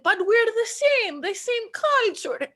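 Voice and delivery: high, slow innocent granny; grandma's protest. Retold or imitated. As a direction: plead it slowly in a high granny voice. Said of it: "my grandma was like".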